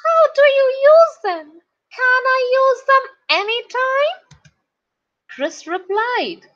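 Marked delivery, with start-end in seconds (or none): 0.0-4.2 high, loud, quick eager schoolboy; Peter. A narrator's reading. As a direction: pitch it up and ask eagerly, fast. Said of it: "Peter asked".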